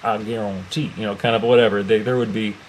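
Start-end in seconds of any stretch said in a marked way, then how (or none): 0.0-0.9 hammed-up Cajun accent, a bit softer; bad Cajun accent. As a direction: attempt a Cajun accent, badly. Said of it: "that was a horrible accent".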